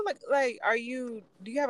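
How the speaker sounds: normal talking voice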